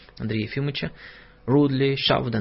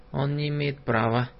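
ordinary delivery

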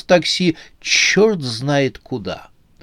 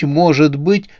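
ordinary delivery, nothing marked

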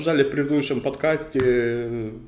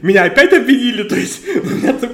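laughing; 8 semitones higher, 6 dB louder than usual. Real, not acted. Laughing while telling.

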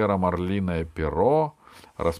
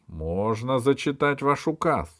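triumphant; 7 semitones higher, 4 dB louder than usual. Acted. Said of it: "не скрывая торжества".

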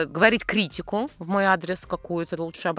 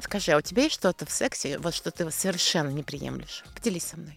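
soft seductive purr, fading quieter; sultry; 4 semitones lower, 8 dB quieter than usual. Acted. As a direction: coax him softly, breathy. Seductive roleplay scene.

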